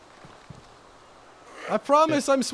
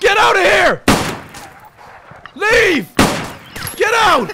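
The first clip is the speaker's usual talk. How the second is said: furious yelling, much higher and louder; angry shout; 8 semitones higher, 8 dB louder, slower than usual. Acted. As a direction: bark at the pantless intruder to get out. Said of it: "you got really angry".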